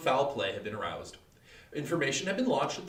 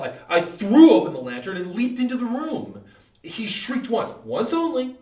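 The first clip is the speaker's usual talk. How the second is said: loud, high, wild climax; frenzied shout; 8 semitones higher, 10 dB louder than usual. Acted. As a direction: burst out as he leaps on the old man. Climax of the attack.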